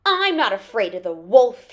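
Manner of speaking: loud, lightly voiced boast; bold Peter. A narrator's reading. Peter watches from behind the gate, loud bold claim. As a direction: declare it boldly and loudly. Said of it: "he said".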